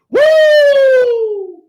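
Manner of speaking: high, loud woo; excited whoop. Real, not acted. Hyped preaching cry.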